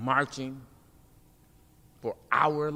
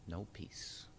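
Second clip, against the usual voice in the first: softer whispered end of the chant; 6 semitones lower, 18 dB quieter than usual. Acted. Poet closing the protest chant, whispered. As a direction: let the slogan die into a whisper.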